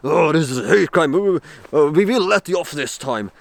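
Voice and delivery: a hint of a stern cop letting him off; police officer. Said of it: "they said".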